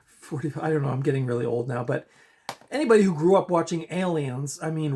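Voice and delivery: ordinary delivery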